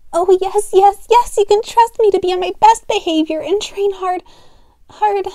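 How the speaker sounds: high, breathless, over-eager pup; eager excitement. Acted. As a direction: gush it out eagerly. Eager apprentice pleading to be trusted.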